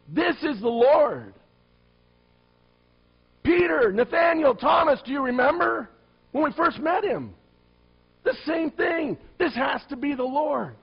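rally your friends with sudden recognition; excited disciple. Acted. John calling to fellow disciples, excited shout.

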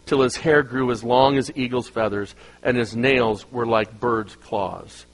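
low, measured scripture reading. Acted. Preacher reading the verse to the congregation, low and solemn. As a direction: read it out solemnly, slowing at the end.

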